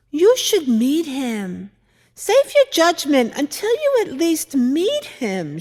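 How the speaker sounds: a hint of the daughter gently pleading; daughter's voice. Mother recalling her daughter's advice, lightly voiced daughter. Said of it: "she would say".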